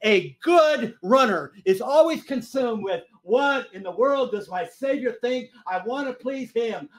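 slow, high, drawn-out declaration; emphatic preaching. Real, not acted. Preacher urging the church to please the Savior, emphatic.

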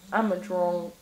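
put-on low, soft "drunk" said as "dronk". Shown as a demonstration. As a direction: round the vowel of "drunk" to an O. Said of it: "D R O N K".

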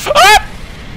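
startled scream. Real, not acted. Jump scare in horror game.

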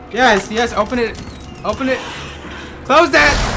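loud, urgent and high; frantic shouting. Real, not acted. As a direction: yell it in a panic. Escaping through door.

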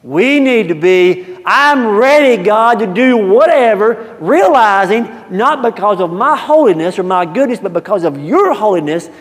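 high, loud, slow impassioned pledge; preaching fervor. Acted. Preacher urging congregation, high and loud. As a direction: lift the room to commitment.

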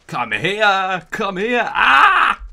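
loud high shouting; battle yell. Real, not acted. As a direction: yell charging into the fight. Chasing enemies, yelling.

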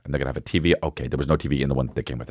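normal talking voice

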